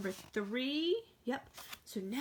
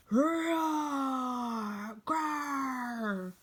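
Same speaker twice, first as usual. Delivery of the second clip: loud growling roar; big hammed-up bear growl; 4 dB louder than usual. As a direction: roar like an angry mother bear. Mother bear bursts out.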